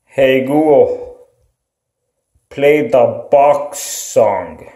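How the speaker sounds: ordinary delivery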